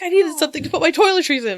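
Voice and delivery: fast, high-pitched excited quote; retold excitement. Friend pleased with his new bag, fast and excited. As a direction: rush it out like a delighted friend. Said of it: "he was very excited".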